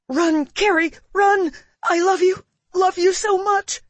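high, loud desperate cry; desperate shout. Acted. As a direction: scream your love in panic. Mom on walkie in danger.

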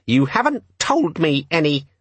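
high, slow petulant voice; sulky demon. A narrator's reading. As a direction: point it out sulkily, like a huffy little gadget. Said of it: "said the demon sulkily".

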